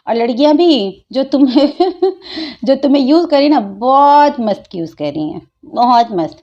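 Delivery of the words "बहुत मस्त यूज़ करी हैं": normal talking voice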